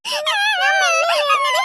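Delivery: slow, high wailing shout through tears; crying shout. Acted. Hurt big sister wails at little sister, sobbing shout. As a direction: sob it out at the top of your voice.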